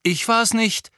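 high, loud protest; blurted denial. Acted. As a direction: blurt it out defensively. Harry blurts a guilty denial.

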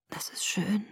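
whispered, drained of all life; lifeless. A narrator's reading. Sick boy hears she is well, lifeless whisper. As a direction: barely breathe it out. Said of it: "Seine Stimme war leblos".

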